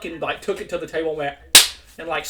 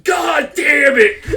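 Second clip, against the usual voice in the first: much louder, higher, slow yell; imitated furious shout; 11 semitones higher, 12 dB louder, much slower than usual. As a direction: bellow it like an exploding coach. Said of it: "and he goes".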